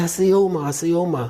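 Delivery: high-pitched imitation of an alarmed daughter; retold frantic answer. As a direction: re-enact a child's insistent cry.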